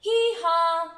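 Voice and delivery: loud, high drawn-out hee-haw; donkey bray. Acted. Donkey braying in a story.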